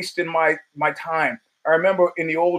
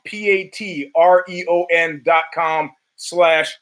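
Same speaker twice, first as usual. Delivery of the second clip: spelled out slowly; slower than usual. Shown as a demonstration. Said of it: "spelling out web address".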